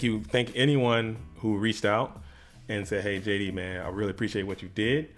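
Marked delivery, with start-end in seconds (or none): none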